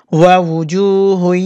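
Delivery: ওয়ুজুহুন slow, its ending held through the nose; nasal ghunna. Shown as a demonstration. Teacher shows idgham, ওয়ুজুহুন with long nasal ending. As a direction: stretch ওয়ুজুহুন into a nasal hum at the end. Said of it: "নাকে নাকে উচ্চারণ করতে হবে".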